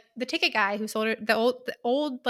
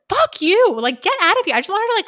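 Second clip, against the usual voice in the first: furious outburst; 10 semitones higher, 6 dB louder than usual. Real, not acted. Reader venting at the villain, furious outburst. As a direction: lose your temper at the villain. Angry rant about the villain.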